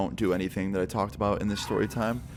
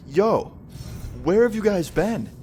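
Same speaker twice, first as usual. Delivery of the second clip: a raised, disbelieving shout to the friends; startled call out; 11 semitones higher, slower than usual. Retold or imitated. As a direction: call out in shock at finding them there. Finds friends at kitchen table.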